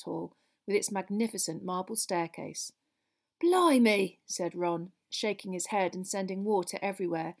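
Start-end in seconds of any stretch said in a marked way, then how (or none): 3.4-4.2 higher, louder exclamation; a drawn-out exclamation of dismay; mild astonishment. Acted. Ron to his friends after the downpour, mildly astonished. Ron drenched on reaching the hall.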